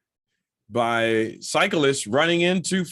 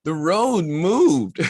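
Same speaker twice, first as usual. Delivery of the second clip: sheepish excuse; 5 semitones higher, 4 dB louder, much slower than usual. Retold or imitated. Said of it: "she says the famed word".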